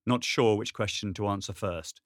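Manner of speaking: ordinary delivery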